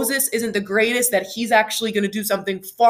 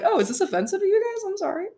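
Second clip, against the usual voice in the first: high, fast, cheeky fake sorry; sassy mock apology; 9 semitones higher, faster than usual. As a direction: tease them with a sassy apology. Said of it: "let's not be that sassy".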